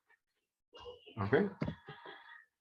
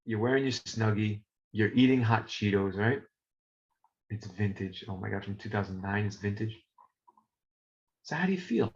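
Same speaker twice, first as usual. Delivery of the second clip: hushed ASMR murmur, softer on vintage; 4 semitones lower, 4 dB quieter, faster than usual. Shown as a demonstration. Teacher guiding students to relax, soft ASMR voice. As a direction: lull the class with a cosy couch scene. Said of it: "now I'm gonna do my A S M R voice".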